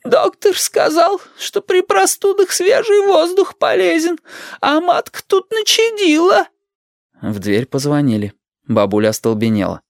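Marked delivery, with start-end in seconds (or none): 0.0-6.5 very high, slow, wailing granny; high-pitched drawn-out old lady's complaint; indignant grandmother. A narrator's reading. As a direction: protest tearfully as a wronged old woman.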